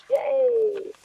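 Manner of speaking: high, bright cheer; cheerful yay. Real, not acted. Cheering nearly done.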